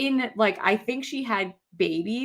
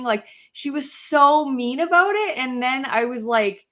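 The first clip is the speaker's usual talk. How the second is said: normal talking voice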